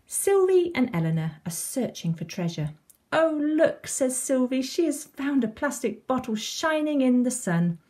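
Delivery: put-on lively storytelling to young children; children's story reading. A narrator's reading. Reading a beach story to children, animated storytelling. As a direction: read it like a picture book, animated.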